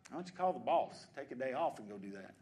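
normal talking voice

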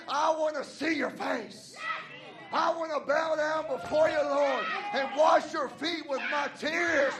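loud, shouted sermon climax; preaching at full force. Acted. Pastor urging the church not to give up, shouting. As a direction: shout it to the pews.

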